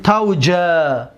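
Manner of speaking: تو جا stretched very slowly, each syllable held; drawn out slowly. Shown as a demonstration. Teacher showing students how to build the imperative, very slowly. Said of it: "دقت بتید".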